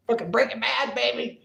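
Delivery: high, jokey imitation of a passing driver; lightly voiced cheering heckler; passerby heckling. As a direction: call it out like a honking stranger.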